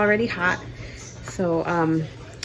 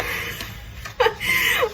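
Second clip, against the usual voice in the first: laugh. Real, not acted. Laughing at own mistake.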